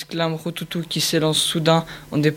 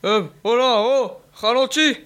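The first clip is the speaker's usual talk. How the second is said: barking hound; 6 semitones higher than usual. A narrator's reading. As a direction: bark it like a tired hunting dog. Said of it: "aboie son poursuivant".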